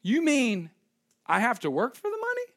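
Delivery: high-pitched, put-on incredulous question; mock disbelief. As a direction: protest as if work were an outrageous idea. Acts out reluctant believer questioning God.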